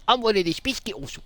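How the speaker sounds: high, quick put-on Scots; squeaky Scottish boast; hammed-up high Scottish accent. Scottish character introduces self.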